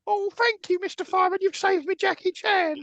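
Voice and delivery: very high falsetto, play-acted thanks; grateful rescue victim. Thanking the fireman for a cat-up-tree rescue, mock grateful. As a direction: gush in a silly squeaky put-on voice.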